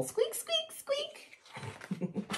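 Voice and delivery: tiny very high-pitched squeaks; mouse squeak. A narrator's reading. Storyteller voices the mouse for preschoolers, high squeaks. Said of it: "the mouse went squeak".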